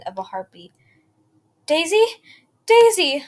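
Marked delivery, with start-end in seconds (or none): 1.7-2.1 loud, rising, frightened call; desperate call. A narrator's reading. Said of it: "I cried desperately". 2.7-3.2 very loud, high, falling cry of panic; desperate cry. A narrator's reading. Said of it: "I cried desperately".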